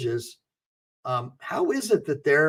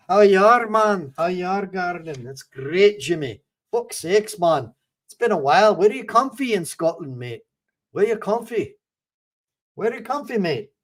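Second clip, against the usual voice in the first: put-on Glaswegian banter, higher pitched; Glaswegian accent; 3 semitones higher than usual. Testing a Scottish chatter with local phrases, in a put-on Glaswegian accent. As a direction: greet a fellow Scot in Glaswegian dialect. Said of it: "if I got a good Glaswegian".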